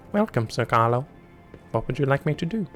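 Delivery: tinny crackly speaker imitation; drive-through speaker voice. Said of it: "Bad drive-through speaker?".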